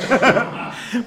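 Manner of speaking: loud, high laughter; real laugh. Real, not acted. Amused by someone in the group.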